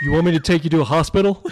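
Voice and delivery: loud, rapid-fire mock PSA line; PSA quote. Dad quoting a PSA to his son on the playground, loud mock concern. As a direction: belt out the public-service line fast. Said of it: "I quote another one of those P S As".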